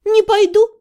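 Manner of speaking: high, firm refusal. A narrator's reading. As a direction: dig in and refuse outright. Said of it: "сказала я твёрдо".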